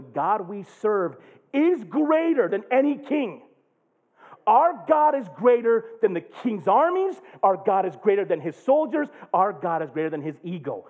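high, loud fervent preaching; full-force preaching. Real, not acted. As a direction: preach it with all you've got.

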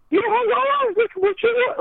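a weird garbled voice behind the door. Retold or imitated. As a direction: slur it like a muffled stranger. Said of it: "this garbled voice is like".

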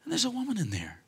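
high, soft incredulous voice; hushed astonishment. Acted. Preacher marvels at a woman in the basket, hushed astonishment. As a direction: squeak it softly, falling at the end. Acts shock at the discovery.

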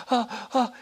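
sharp high gasps of fear, retold; frightened gasps. Remembering the shamanic death, high frightened gasps. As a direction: gasp out ah, ah as if terrified.

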